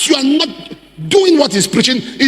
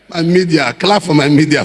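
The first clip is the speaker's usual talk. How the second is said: usual speaking voice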